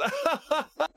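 high mocking caller's laugh; mock woman laughing. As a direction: imitate her cheesy laugh. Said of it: "started with a woman laughing".

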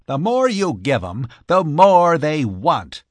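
indignant griping; vehement complaint. A narrator's reading. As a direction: complain with energy, leaning on the words. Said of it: "he complained".

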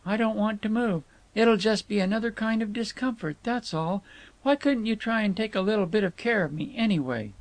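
high, fretful whine; querulous complaint. A narrator's reading. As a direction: whine for some looking after. Querulous woman keeps complaining.

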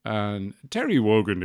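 usual speaking voice

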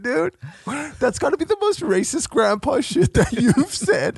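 high laughing, loud outburst; laughing high shout. Real, not acted. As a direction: laugh while yelling at grandpa. Laughing rant at grandpa.

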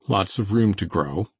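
plain reading voice

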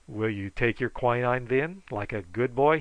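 ordinary delivery, nothing marked

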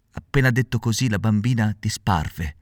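usual speaking voice

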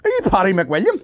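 high, loud eager fan; imitated fan. Comic imitating a fan who confuses him with another comedian. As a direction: call out like a thrilled stranger. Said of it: "fan recognising a comedian".

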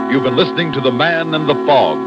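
ordinary talk

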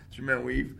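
ordinary talk, nothing marked

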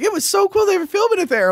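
put-on excited girlfriend.

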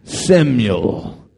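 low, loud, booming divine call; God calls the sleeping boy, deep and loud; the Lord calling. Acted. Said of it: "the Lord called out".

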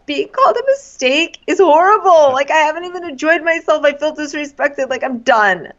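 high, worked-up imitation of the woman; playing Kaylin. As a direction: rattle off her hurt with hammed-up indignation. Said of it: "I'll be Kaylin".

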